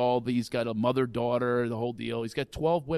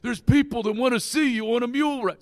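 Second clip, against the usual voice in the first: father yelling; 8 semitones higher, 5 dB louder than usual. Retold or imitated. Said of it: "I get a knock".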